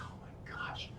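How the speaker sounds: very soft whispered gasp of recognition; barely-there whisper, stunned; hushed disbelief. Retold or imitated. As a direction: whisper it to yourself. Retells hushed reaction seeing the rival.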